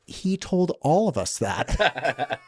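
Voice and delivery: slow, laughing through the words. Retold or imitated. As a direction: laugh it out at him. Sister laughing at him.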